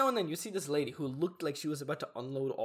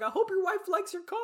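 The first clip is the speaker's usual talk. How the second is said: very high, squealed parting wish; giddy farewell; 3 dB louder than usual. Retold or imitated. Driver calling goodbye to a stranger, high giddy call. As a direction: call it out as the car leaves.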